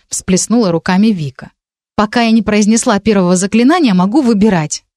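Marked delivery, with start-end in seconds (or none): none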